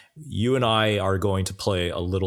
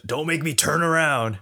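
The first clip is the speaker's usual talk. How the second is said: put-on higher, warning the kids in back; stern dad threatening from the driver's seat; exasperated parent; 7 semitones higher than usual. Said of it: "he's like telling his kids in the back of the car".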